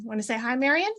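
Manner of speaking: ordinary talk, nothing marked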